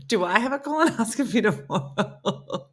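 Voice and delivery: said through real laughter; laughing. Real, not acted. Cracking up at odd connection.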